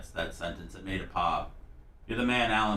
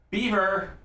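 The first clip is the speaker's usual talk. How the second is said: silly catchphrase; 11 semitones higher, 3 dB louder than usual. Pretend. Calls out catchphrase.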